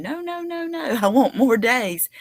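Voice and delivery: put-on high squeaky childish whine of refusal; mock protest. People refusing to let their last day end, mock whiny tantrum. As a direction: play a kid throwing a mock tantrum. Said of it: "go kicking and screaming all day long".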